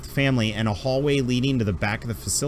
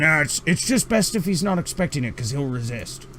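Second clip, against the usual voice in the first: much higher, fussy put-upon doctor voice; grumpy alien doctor; 3 semitones higher than usual. Acted. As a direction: huff like an overworked old doctor. GM voices doctor answering player.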